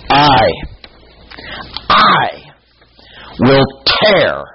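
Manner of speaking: loud, rising declaration; booming voice of God. Acted. Preacher voicing God to Israel, loud and commanding. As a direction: proclaim it as God tearing prey.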